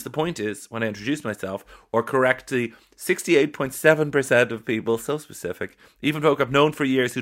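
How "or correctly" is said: normal talking voice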